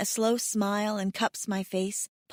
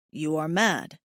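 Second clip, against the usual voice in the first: male mate voice; 6 semitones lower than usual. A narrator's reading.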